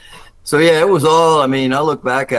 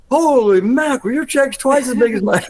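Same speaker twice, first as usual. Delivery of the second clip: high, amazed exclamation; retold mock astonishment, much higher; astonished; 11 semitones higher than usual. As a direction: gasp it out at the paycheck. Sees friend's bigger paycheck.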